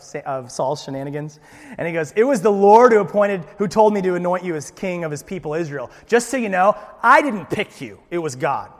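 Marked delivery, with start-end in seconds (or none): 2.1-8.7 loud, higher stern scolding; stern rebuke. Acted. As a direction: put him firmly in his place. Samuel rebuking Saul.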